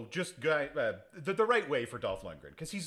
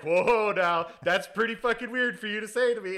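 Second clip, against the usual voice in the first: louder, higher startled whoa; mock startled; 8 semitones higher, 7 dB louder than usual. Dolph reacts to the owner, loud and startled. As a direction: jump back, hammed-up shock. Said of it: "And he's like".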